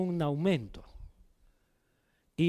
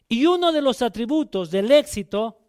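ordinary talk, nothing marked

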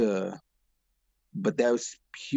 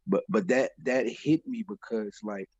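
ordinary talk, nothing marked

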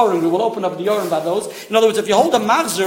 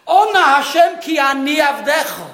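raised, louder demonstration of a prayer call; congregational call; 7 semitones higher, 5 dB louder than usual. Retold or imitated. Teacher voicing the Hallel verse for his class, calling out. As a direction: call it out high like a shul crowd. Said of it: "I don't see here any excitement".

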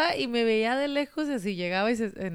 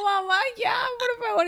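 put-on pleading teen; 14 semitones higher than usual.

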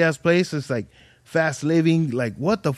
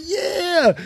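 excited cheer. Retold or imitated. Crowd cheering his show.

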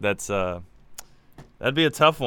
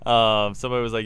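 ordinary delivery

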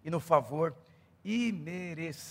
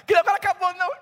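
exasperated question; 13 semitones higher, 11 dB louder, faster than usual. Retold or imitated. Impatient person asking again.

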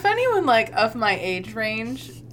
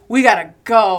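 mock angry; 9 dB louder than usual. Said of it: "angry, and he's like".

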